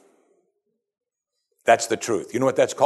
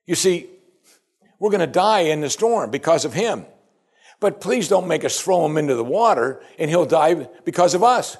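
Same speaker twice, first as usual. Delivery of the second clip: higher-pitched acted appeal; pleading sailors; 7 semitones higher than usual. Sailors beg God not to make them drown Jonah, higher pleading. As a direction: plead the dilemma upward to God. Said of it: "And they said".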